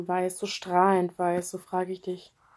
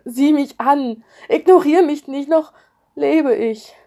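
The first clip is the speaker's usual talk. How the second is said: flehender Ruf; 7 semitones higher, 10 dB louder than usual. Acted. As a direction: beg her to look at you, loudly. Pleading with mother who ignores. (laut flehend rufen)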